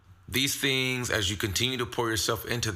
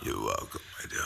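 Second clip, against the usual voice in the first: whispering; 13 semitones lower, 7 dB quieter, faster than usual. Acted. Whispered royal banter exchange.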